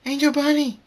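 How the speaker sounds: very high, loud cry of a name; horrified scream. A narrator's reading. Fluttershy screams as Angel Bunny is hurt, horrified scream. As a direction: scream for a pet in danger. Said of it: "Fluttershy screams".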